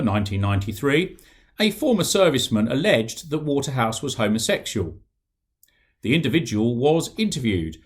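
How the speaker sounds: steady, formal read-aloud of official documents; book reading. Acted. Author reading his book to an audience, measured reading voice. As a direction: read the report out clearly, letting the facts speak.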